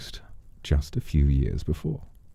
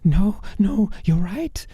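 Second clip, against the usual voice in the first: frantic begging man; slower than usual. Acted. Man begs before the sword falls, high and frantic. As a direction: blurt it out in a high panic.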